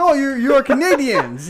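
high, loud, drawn-out waiter imitation; excited waiter. Waiter spotting the tourists' Canadian pins, imitated high and loud. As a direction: act out a waiter loudly discovering the tourists' nationality. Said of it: "He's like".

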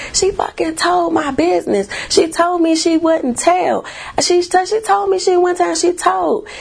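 put-on high pitched kid's furious accusation; retold furious outburst. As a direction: wail it like a betrayed eight-year-old. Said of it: "I was like".

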